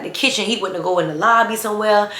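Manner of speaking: ordinary delivery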